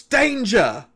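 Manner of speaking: high, loud alarm cry from a magic ring; sudden high-pitched yell of warning; loud warning shout. Acted. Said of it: "the ring loudly shouts".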